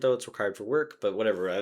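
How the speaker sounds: ordinary talk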